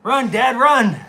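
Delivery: high, loud mock cheering; Cruz. Dog making dad chase the ball, teasing imitation. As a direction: egg him on in a playful put-on voice. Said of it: "So it's more like".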